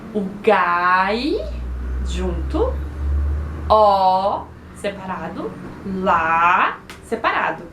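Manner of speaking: low, stretched "gai", "ó", "lá", each apart; "gai", "ó", "lá" held long with pauses between; syllable by syllable. Shown as a demonstration. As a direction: sound out "gai", "ó", "lá" as separate low beats. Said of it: "Essa é a divisão silábica normal".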